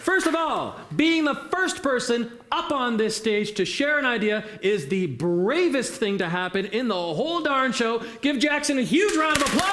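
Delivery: high-pitched, emphatic emcee build-up; rally the audience to applaud; rousing hype. Real, not acted. Host rallies applause for volunteer.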